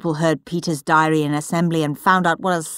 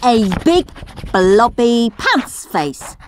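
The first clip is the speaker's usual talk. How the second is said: put-on gleeful cheeky boy; 4 semitones higher, slower than usual. Henry's doctored diary read as a slow, gleeful cheeky boy. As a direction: savour every naughty word.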